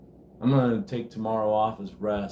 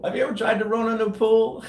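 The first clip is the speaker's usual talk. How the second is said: incredulous question; 12 semitones higher, 4 dB louder than usual. Real, not acted. Incredulous question to viewers, then laughs.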